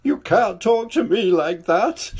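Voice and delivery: a hurt sob of protest; sobbing king. A narrator's reading. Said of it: "sobbed the king".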